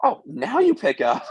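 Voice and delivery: high, laughing tease; slowed, high and amused; teasing laugh. Retold or imitated. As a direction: laugh through the jab. Teasing mom on phone.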